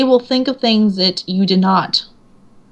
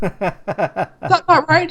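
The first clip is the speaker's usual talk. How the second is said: slow disbelief; 7 semitones higher, much slower than usual. Retold or imitated. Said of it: "He goes".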